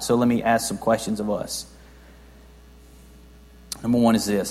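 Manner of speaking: ordinary talk, nothing marked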